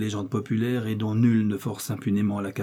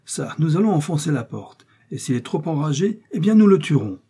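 exasperated gentleman, voice raised higher; angry threat; 6 semitones higher, 5 dB louder than usual. Acted. Exasperated gentleman threatens to break down the cellar door, angrily. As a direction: build to a louder, high threat at the end.

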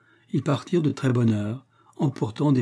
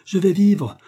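higher, louder cry with the weight on "vivre"; joyful cry; 8 semitones higher, 6 dB louder than usual. A narrator's reading. Totaro, suddenly cured, crying out with joy. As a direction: shout it out, landing on "vivre". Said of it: "Puis il s'écria".

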